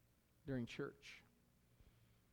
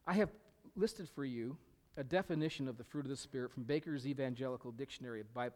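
ordinary delivery

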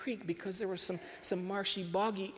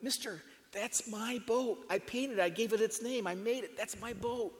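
pleading; 5 semitones higher than usual. A narrator's reading. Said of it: "Jeffrey told the man".

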